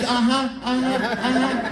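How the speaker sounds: slow, distracted uh-huhs, not really listening; husband half-listening to his wife, bored uh-huhs; absent uh-huh. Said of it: "It's replaced with".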